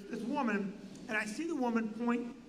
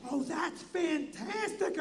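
high, slow, wacky delight; 4 semitones higher, slower than usual. Retold or imitated. Entertainer shakes a comic's hand, oddly drawn-out delight. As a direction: draw the praise out high and strangely. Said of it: "how the [name] are talking".

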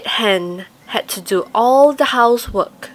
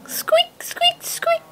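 tiny mouse squeaks; slower than usual. A narrator's reading.

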